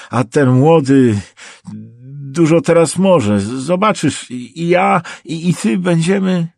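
high, loud, stammering desperation; nervous babbling. A narrator's reading. Trapped prosecutor bargaining with his captor, babbling nervously. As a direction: stammer out promises, voice pushed high. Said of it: "bełkotał nerwowo".

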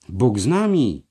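loud, rousing cry; calling out. A narrator's reading. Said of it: "wołał Liechtenstein". (donośne, podniosłe wołanie)

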